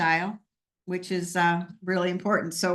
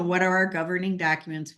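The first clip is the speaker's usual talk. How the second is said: ordinary delivery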